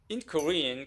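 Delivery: ordinary talk, nothing marked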